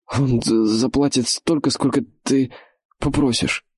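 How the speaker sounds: usual speaking voice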